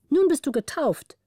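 hoch gerufen, am Anfang laut; übermütiger Ausruf. A narrator's reading. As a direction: ruf es lachlustig wie ein Kind. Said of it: "rief Margareta". (übermütig ausgerufen)

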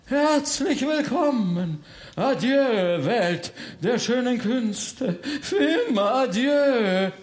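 high, slow theatrical farewell; grand melodrama. Acted. Baron bids the world of arts farewell, melodramatic. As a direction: draw out a tragic goodbye. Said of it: "liebt auch das große Drama".

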